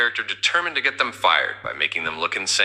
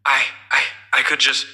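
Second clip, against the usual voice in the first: repeating I, pitched up and rushed; stammering; 5 semitones higher than usual. Acted. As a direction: stutter out the start, rattled.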